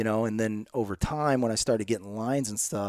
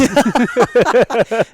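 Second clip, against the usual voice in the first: loud, high-pitched laugh; real burst of laughter; 12 semitones higher, 13 dB louder than usual. Real, not acted. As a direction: crack up loudly at a joke. Laughs at the interviewer's joke.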